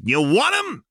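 high, loud shouted demand. Acted. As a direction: shout it to the whole room. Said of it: "threw her arms wide and shouted".

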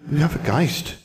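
put-on high, childlike little-girl voice; ghost girl. Ghost girl greeting Alexandra, high childlike voice. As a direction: play a small prim ghost child.

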